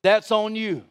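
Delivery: high, emphatic preacher's voice; forceful sermon declaration; impassioned preaching. Real, not acted. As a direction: drive each phrase home from the pulpit.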